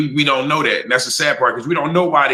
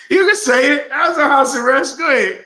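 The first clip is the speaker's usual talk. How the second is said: excited teasing; 9 semitones higher than usual. Real, not acted. Teasing interruption, laughing high.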